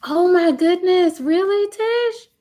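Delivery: high, loud gasp of disbelief; can't believe what Tish wrote; shocked. Real, not acted. Streamer reading Tish's comment, stunned.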